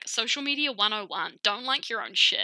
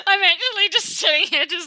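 high, loud, breaking into laughter; can barely get it out for laughing; laughing to tears; 7 semitones higher, 8 dB louder than usual. Real, not acted. Cracking up at the fondant joke, laughing to tears. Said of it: "like, in tears".

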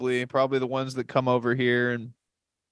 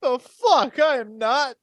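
much higher, indignant squeal; mock offended; 8 semitones higher, slower than usual. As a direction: ham up his outrage. Mocking friend's offended reaction.

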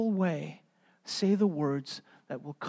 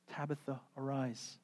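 soft command; 3 semitones lower, 14 dB quieter than usual. Retold or imitated. Quiet quote.

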